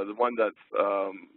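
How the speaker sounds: ordinary delivery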